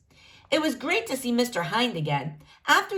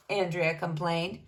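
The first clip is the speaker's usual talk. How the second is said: ordinary delivery, nothing marked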